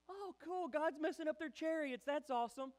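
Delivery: very high, gushing spectator voice; mock amazement. Israelites looking back at the chariots, mock-excited cheer.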